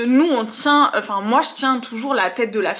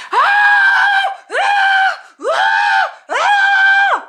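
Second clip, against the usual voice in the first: shrill screams, far higher and louder; imitated scream; 8 dB louder, much slower than usual. Imitating the unconscious client's screams, high piercing shrieks. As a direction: scream like someone possessed. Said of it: "elle hurle, elle fait".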